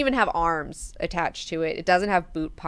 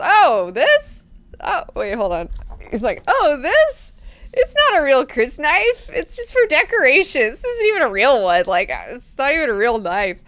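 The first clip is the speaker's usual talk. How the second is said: high, loud, nervous backpedal; flustered Garen; 7 semitones higher, 7 dB louder than usual. Retold or imitated. Garen nervously telling Duncan his knife is fake. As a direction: squeak out excuses like a caught fraud.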